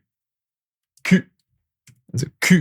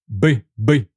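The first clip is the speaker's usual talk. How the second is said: ordinary talk, nothing marked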